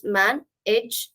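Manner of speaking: "man, edge" in two separate portions; split into syllables. Shown as a demonstration. Teacher showing learners the two portions, split into syllables. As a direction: break it as "man", then "edge". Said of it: "in two portions".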